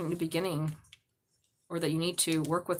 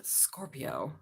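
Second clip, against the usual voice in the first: whisper; 7 semitones lower, 11 dB quieter than usual. Acted. Whispered aside to the camera.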